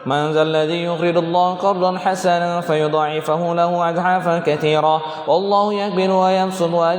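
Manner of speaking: rapid run-on Quran recitation; bacaan cepat-cepat. Shown as a demonstration. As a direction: rattle the verse off without pauses. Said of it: "Nanti kalau cepet-cepet". (mengaji terburu-buru tanpa jeda)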